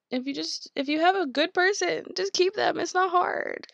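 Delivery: just keep them, it's obvious; exasperated plea. Real, not acted. Exasperated advice to viewers.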